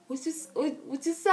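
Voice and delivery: mock stunned double-take; acted disbelief. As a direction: stumble over it as if stunned. Acts stunned reply to proposal.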